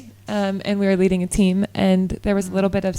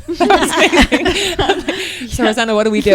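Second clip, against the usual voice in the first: laughing; 8 semitones higher, 11 dB louder than usual. Real, not acted. Laughing at friend's remark.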